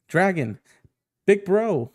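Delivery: calling out. Acted. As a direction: call to your big brother for help. Character calls out to Dragon.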